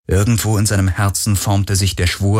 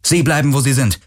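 put-on tense young woman; 6 semitones higher than usual. A narrator's reading. Girl threatens with pistol.

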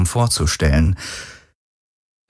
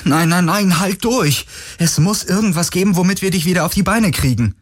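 desperate pleading; 12 semitones higher than usual. Acted. Desperate plea to dying friend.

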